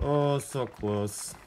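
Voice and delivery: slow, drawn-out groan of disappointment; disappointed. Real, not acted. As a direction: stretch it out, gutted. Ball just misses the hole.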